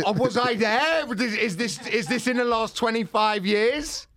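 high, slow incredulous questioning; mock incredulous. Real, not acted. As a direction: play up disbelief.

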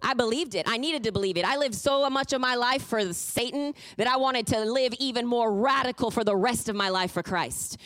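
ordinary talk

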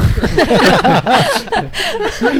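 high, loud burst of laughter; big, high-pitched laughing fit. Real, not acted. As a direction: crack up helplessly at an absurd idea. Bursts out at Whoopi suggestion.

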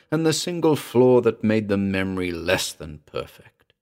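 ordinary delivery, nothing marked

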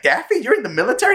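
high, fast surprised Bugs; quick high cartoon rabbit surprise. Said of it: "He's like".

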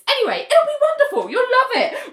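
very high, fast gush; hammed-up breezy enthusiasm; Laura Dipson. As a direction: brush objections aside in a high, rushed trill. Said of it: "And Laura Dibson's like".

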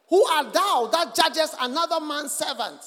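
the voice of God shouting in the dark room; booming divine voice. A narrator's reading. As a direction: thunder the question down at him. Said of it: "the voice came again".

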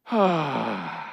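long weary sigh out; tired sigh. Shown as a demonstration. Pastor demonstrating a tired noise to the congregation, slow weary sigh. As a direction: let out a worn-out sigh. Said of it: "what does a tired noise sound like".

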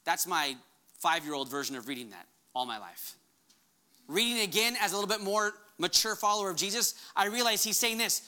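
usual speaking voice

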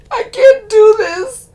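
high, slow sobbing plea, loud. A narrator's reading. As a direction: cry out the words. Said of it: "she sobs".

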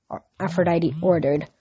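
usual speaking voice

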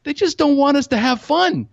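high, insistent revelation; mock-outraged stoner working out the plot; conspiracy ranting. Stoned teen with conspiracy theories, ranting. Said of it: "start to get these conspiracy theories".

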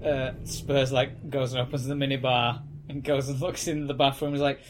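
ordinary delivery

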